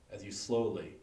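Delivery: ordinary delivery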